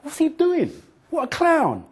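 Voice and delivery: high, scornful jeering; high mocking jeers; mocking crowd. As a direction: sneer at the fool on stage. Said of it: "the crowd looked at me like this".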